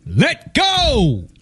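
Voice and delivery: majestic voice. Acted. Voice from the top instructs the hanging man, majestic command. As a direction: intone it grandly from on high. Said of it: "The voice says".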